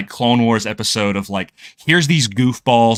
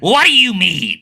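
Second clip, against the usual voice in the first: high, louder dopey alien question; goofy alien voice; 8 semitones higher, 4 dB louder than usual. Retold or imitated. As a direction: play it like a dim-witted sidekick. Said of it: "who I always envision talking like this".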